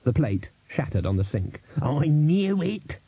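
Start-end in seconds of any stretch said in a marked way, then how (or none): 1.8-3.0 slow, high-pitched outburst, no real volume; shouting old servant. A narrator's reading. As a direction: drag out an exasperated cry in character. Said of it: "Albert shouted".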